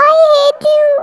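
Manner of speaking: high, drawn-out whine; wailed outburst. Acted. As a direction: stretch it into a sulky wail. Sulking sister ends her quarrel. (रूठकर खींचती हुई ऊँची चीख)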